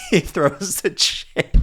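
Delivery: laughing through the words, pitch up; can't hold the laugh in; cracking up while retelling the chair throw. Real, not acted.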